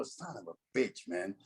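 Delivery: hushed retold curse to himself; muttered dismay. Sees his rivals in the county jail tank, muttered dismay.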